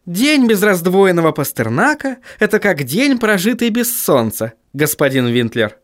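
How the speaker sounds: high, sugary sweet voice. A narrator's reading. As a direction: say it sweetly, overly nice. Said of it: "очень милым голосом произнесла Сахариса".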